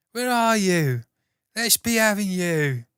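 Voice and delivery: loud, high Cockney bark; Cockney quote. Retold or imitated. Shouting a Cockney quote at a stranger. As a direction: call out like a little Cockney football fan. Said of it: "Little Cockney guy".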